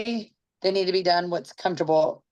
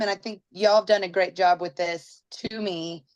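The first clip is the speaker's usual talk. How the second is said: ordinary delivery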